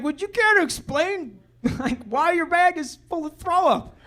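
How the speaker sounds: high, laughing mock-stern question; mock stern mother. Wife questioning son about his bag, high and laughing. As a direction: play the mom, cracking up as you ask.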